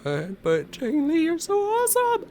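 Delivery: high-pitched, loud fangirl gush; gushing praise. Real, not acted. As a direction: burst out in high, squealing adoration. Fan gushing at game character.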